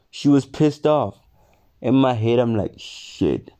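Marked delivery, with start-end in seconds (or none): 2.8-3.5 quiet. Retold or imitated. Panicked thought in head.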